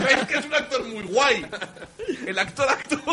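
high-pitched, helpless laughing delivery. Real, not acted. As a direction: talk through a fit of laughter. Se parte de risa.

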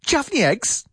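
"Chafney eggs" high, blurred into one word; teacher models "Chafney eggs" as one slurred chunk; run together as one word. Shown as a demonstration. Teacher repeats the tricky phrase for students, sounds run together. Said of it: "a tricky one, a difficult one".

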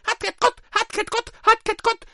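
very high squeaky clown yelps; clown alarm cries. Pretend. Despairing clown calling out, high squeaky yelps. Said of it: "Estos son llamadas de alarma".

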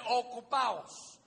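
"Ocupaos" drawn out very slowly and loud, falling at the end; solemn stretched "Ocupaos" with a falling end; slow emphatic quote. Shown as a demonstration. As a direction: stretch out every syllable of "Ocupaos" like scripture. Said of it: "No dice preocupaos, dice ocupaos".

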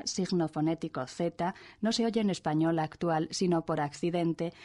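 ordinary talk, nothing marked

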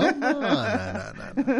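laughing no. Real, not acted. Laughing denial of pillow throw.

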